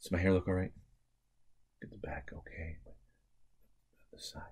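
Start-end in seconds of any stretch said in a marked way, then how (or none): 1.8-4.4 soft unvoiced whisper; whispered aside to the helper. Real, not acted. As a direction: murmur it as a private aside. Quiet aside during wig.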